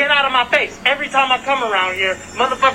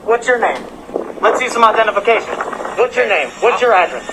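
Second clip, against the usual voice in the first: usual speaking voice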